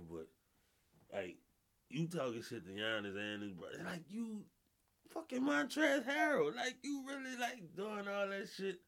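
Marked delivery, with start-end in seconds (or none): 3.8-8.8 high squeaky mocking of the player; mock disbelief at his nerve; mock incredulous. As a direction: voice jumps way up in disbelief.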